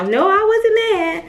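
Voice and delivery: high, slow mock denial from mom; mom denying. Imitating her mom denying she got mad, high and drawn out. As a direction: play mom insisting she wasn't mad. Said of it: "She'll tell you now".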